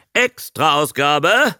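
high, drawn-out newsboy cry; shouting Abe. A narrator's reading. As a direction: call it out slowly like a headline. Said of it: "schrie Abe".

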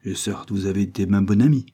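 ordinary delivery, nothing marked

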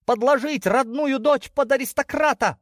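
very high, loud indignation; outraged. Acted. Daughter outraged at being offered to an aristocrat, indignant.

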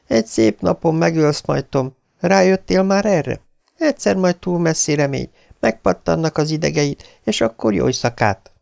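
a wife's ominous prophecy in the dark; lightly voiced wife, warning; Beverly's voice.

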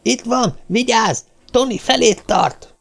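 high, urgent warning cries; alarmed high shout to a companion; sudden shout. A narrator's reading. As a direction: call out a sharp warning, pitched high. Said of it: "Alec Ferguson felcsattanó kiáltását".

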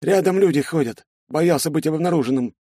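put-on a scared young sergeant answering; frightened soldier. A narrator's reading. (испуганный солдат, высоким голосом)

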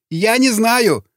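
high-pitched pleading protest; frightened denial. A narrator's reading. Prisoner questioned about citadel, frightened denial. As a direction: protest ignorance in a high, scared voice.

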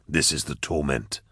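low, soft, grave character voice; brooding Eisenhorn. Acted. Eisenhorn confessing his torment to Endor, low and grave. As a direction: say it quietly and low.

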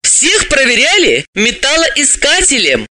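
slow, high-pitched, irritated reminder; annoyed, drawn-out insistence; cross reminder. A narrator's reading. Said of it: "сердито напомнила".

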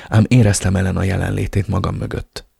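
usual speaking voice

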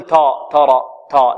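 "تا" darkened toward an emphatic "taw"; wrong heavy taa. Shown as a demonstration. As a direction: thicken the t and its vowel in "ترى". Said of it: "نفخم، لا". (تفخيم التاء في "ترى" خطأً)

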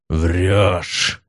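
growled demon accusation; growling demon. Acted. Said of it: "прорычал демон".

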